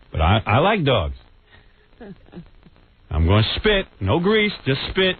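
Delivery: normal talking voice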